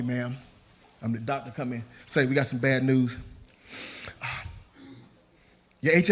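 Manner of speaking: low, soft bad-news voice; hushed, low-pitched mock-serious delivery; solemn doctor. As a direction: break it gently, like a doctor with grim results. Plays doctor breaking bad news.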